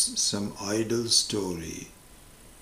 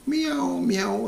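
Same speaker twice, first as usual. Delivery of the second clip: mock high cat meow; 4 dB louder than usual. Psychiatrist imitates the cat for patient, high meow. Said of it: "sitting there saying".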